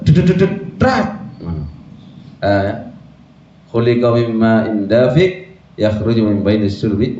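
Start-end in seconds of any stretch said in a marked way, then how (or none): 0.0-1.1 jerky imitated spurts, rising high at the end; stuttering spurt noises ending in a high pop; spurting sound effect. Shown as a demonstration. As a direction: mimic something coming out in bursts. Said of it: "tersendat-sendat".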